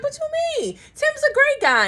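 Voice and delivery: high, incredulous squeak of disbelief; surprised disbelief. Retold or imitated. Wife defending a friend, high-pitched disbelief. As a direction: shoot the pitch way up in shock. She questions him, surprised.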